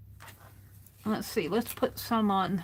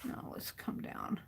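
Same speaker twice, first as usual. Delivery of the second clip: whisper; 14 dB quieter than usual. Real, not acted. Whispering while she works.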